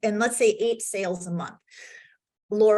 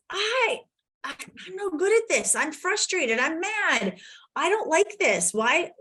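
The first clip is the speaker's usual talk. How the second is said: higher-pitched mock frustrated rant; retold frustration; 8 semitones higher than usual. Coach voicing her own Friday frustration, mock exasperated. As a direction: replay your own fed-up inner voice. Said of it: "I was frustrated".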